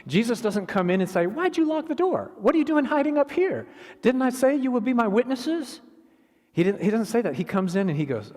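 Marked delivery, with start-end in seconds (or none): 1.3-5.8 high-pitched, loud telling-off; mock scolding. Preacher acting the scolding Jesus didn't give the disciples, mock scolding. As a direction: pile up accusing questions, high and hammed-up.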